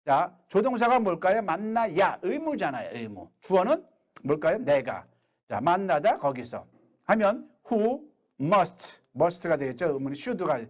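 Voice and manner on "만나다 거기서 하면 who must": ordinary delivery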